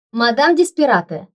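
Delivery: loud, high cry of alarm; deafening yell. A narrator's reading. Secretary yelling in the boss's ear, deafening shout. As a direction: holler it right by the boss's ear. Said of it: "раздался оглушительный вопль".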